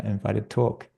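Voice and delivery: ordinary talk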